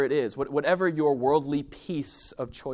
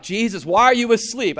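freaking out; 9 semitones higher, 12 dB louder than usual. Retold or imitated. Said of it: "And they freak out".

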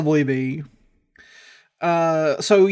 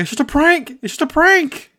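mock prankster; 9 semitones higher, 3 dB louder than usual.

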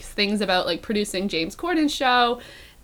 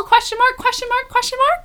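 much higher, squeaky rising repeats; comic high-pitched reading; 14 semitones higher than usual. Pretend. Host reading out tell-all headline, comic high-pitched voice. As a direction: read each punctuation mark out in a high voice.

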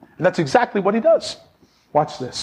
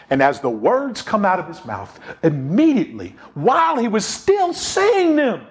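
ordinary delivery

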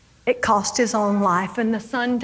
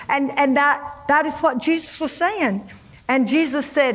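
usual speaking voice

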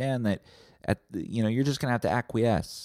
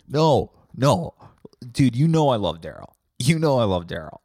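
louder, insistent mock denial; defensive protest; 2 semitones higher, 5 dB louder than usual. Friend denying he laughed, louder and defensive. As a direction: protest your innocence firmly. Acted denial to accusing brother.

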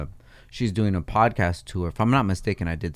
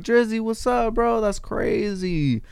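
much higher, starstruck hello; hyped greeting; 11 semitones higher, 4 dB quieter, slower than usual. Pretend. Imagining greeting [name] on the pod, high and hyped. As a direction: greet him like a thrilled fan. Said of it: "I just wanna have him here and be like".

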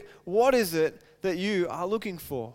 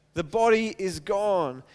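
ordinary talk

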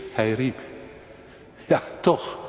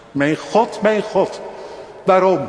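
kreet van Jezus; 8 semitones higher, 6 dB louder, slower than usual. Acted. Jezus roept aan het kruis.

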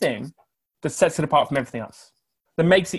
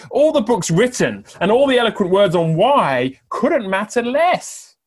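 rising emphatic cry; 8 semitones higher, 8 dB louder than usual. Real, not acted. Preacher's emphatic climax.

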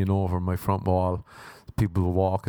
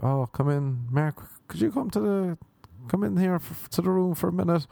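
a hint of parents calling him in, higher; mock-sweet summons, pitched up; imitating parents; 8 semitones higher than usual. Parents summoning son to room.

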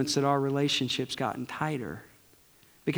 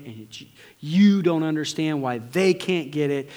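ordinary delivery

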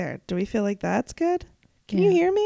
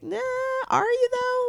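mocking doubt. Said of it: "you're like".

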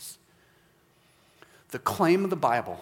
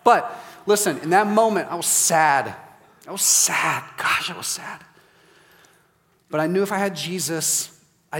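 emphatic preaching; 5 semitones higher, 7 dB louder than usual. Real, not acted. Preacher stressing past sadness.

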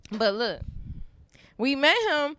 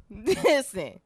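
normal talking voice

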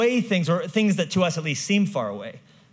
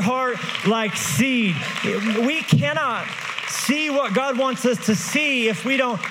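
full-force preaching, raised and loud; 5 semitones higher, 3 dB louder than usual. Acted. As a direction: build the sermon to a loud climax.

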